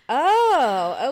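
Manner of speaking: long, loud, rising "oh"; big drawn-out retold relief; dawning realization. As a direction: stretch it out loudly as it sinks in. Retold moment of sudden realization.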